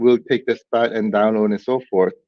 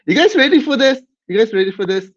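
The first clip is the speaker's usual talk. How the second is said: much higher, louder hype question; hyped up; 10 semitones higher, 4 dB louder, faster than usual. Real, not acted. Host hyping viewers before the rematch, excited. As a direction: pump up the crowd.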